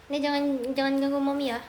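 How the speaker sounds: normal talking voice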